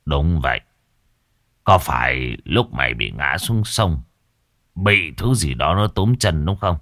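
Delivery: low boastful sorcerer; old master boasting. Acted. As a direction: brag about your power. Master questions patient.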